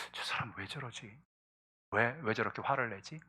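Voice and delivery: very soft, wondering to oneself; hushed puzzlement. Acted. Wife wondering at her angry husband, quietly puzzled. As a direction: murmur it as a private thought.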